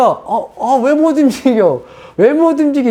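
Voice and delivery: high-pitched baffled alarm; retold alarm. Preacher retelling being unable to move, mock alarm. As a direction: replay the shock of a body not responding.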